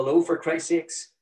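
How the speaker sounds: ordinary delivery, nothing marked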